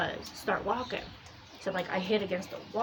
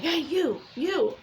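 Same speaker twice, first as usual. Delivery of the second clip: mock call, pitched high; 8 semitones higher, slower than usual. Haunted figures spotting someone on the steps, calling out high. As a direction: call after someone getting away, higher.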